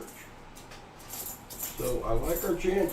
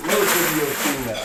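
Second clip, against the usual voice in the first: ordinary talk, nothing marked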